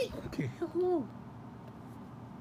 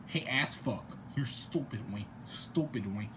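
very low, softer mock insult; low silly taunt dying out; put-on deep voice; 8 dB quieter than usual. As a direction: repeat it deep and low, fading away.

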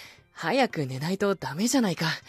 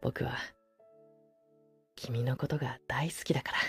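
soft tender voice close by; pillow talk; 6 semitones lower, 3 dB quieter, faster than usual. Acted. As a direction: murmur lovingly to soothe to sleep. Sleeping beside listener, tender.

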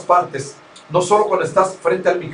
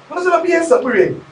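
female caller; 11 semitones higher than usual. Retold or imitated. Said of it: "de la mujer".

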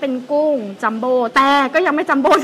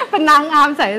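laugh; 3 semitones higher, 6 dB louder than usual. Real, not acted. Jokes about being beauty queen.

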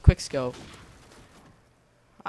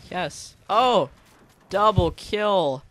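high loud drawn-out celebration; excited cheer; 5 semitones higher, 5 dB louder, much slower than usual. Real, not acted. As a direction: savor the double kill, slowly. Celebrating a double kill.

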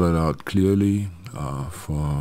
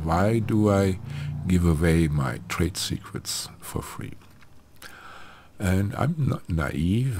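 usual speaking voice

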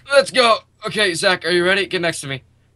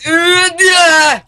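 frustrated yell; 9 dB louder, much slower than usual. Real, not acted. Frustrated at failed jump.

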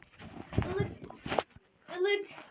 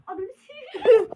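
laughing; 3 semitones higher, 11 dB louder than usual. Real, not acted. Laughing exclamation.

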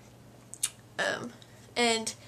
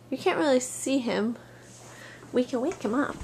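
normal talking voice

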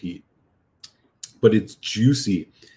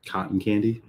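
ordinary talk, nothing marked

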